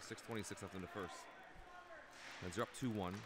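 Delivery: brisk running hockey commentary; live play-by-play. Acted. Commentator calling the game for viewers, brisk play-by-play. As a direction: call the action as it happens.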